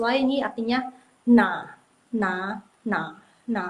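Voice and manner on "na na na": "Na" said low in the third tone, dipping down. Shown as a demonstration. Teacher drills the third tone of "Na" for students, low and dipping. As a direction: drop "Na" low and dip it, third tone. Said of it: "Nada ketiga".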